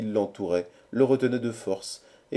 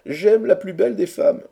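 high, loud declaration of love; lovesick declaiming; 11 semitones higher, 8 dB louder than usual. A narrator's reading. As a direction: proclaim your love to the whole company. Lovesick orator declares his love. (déclaration d'amour clamée haut et fort)

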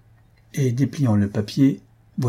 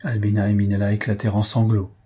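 ordinary delivery

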